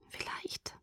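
barely audible whisper; hushed, doubtful murmur; quietly. A narrator's reading. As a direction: breathe it out very softly. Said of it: "sagte sie leise".

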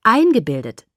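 "Eingebildet" hit loud on the first syllable, then falling; pointed echo. Acted. Bella echoes Jacob's word "Eingebildet", pointedly. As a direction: throw "Eingebildet" back with a hard first syllable. Throws his word back at him.

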